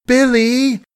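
high, loud wail for help; wailing cry. A narrator's reading. Margaret wails for Billy, high and loud. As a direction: cry out his name in terror. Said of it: "she wailed".

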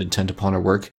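plain reading voice

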